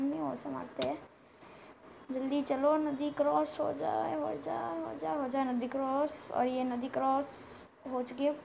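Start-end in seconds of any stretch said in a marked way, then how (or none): none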